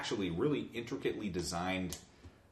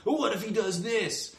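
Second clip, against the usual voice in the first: high, loud, goofy voice of a careless filmmaker; mock clueless filmmaker; 12 semitones higher, 7 dB louder than usual. Mocking the studio writers as they make up rules. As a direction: play the writer who improvises without a plan.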